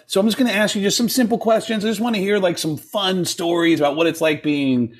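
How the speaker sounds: lightly voiced interviewer, pitched high; upbeat producer.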